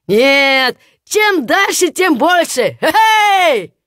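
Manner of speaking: high, laughing whoop; laughing shout. Acted. Hans boasting to his brothers, laughing shout. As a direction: crow with delight at your finds.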